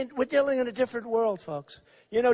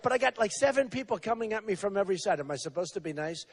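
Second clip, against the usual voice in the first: ordinary delivery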